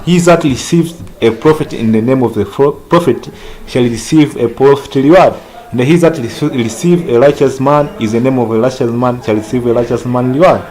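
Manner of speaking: scripture reading. Acted. Reads scripture aloud.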